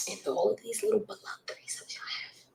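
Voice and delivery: hushed aside; whisper. Real, not acted. Whispering about threesomes with a child nearby. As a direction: drop to a whisper. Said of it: "I'm whispering because there's a child".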